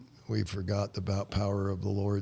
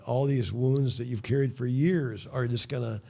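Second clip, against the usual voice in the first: ordinary talk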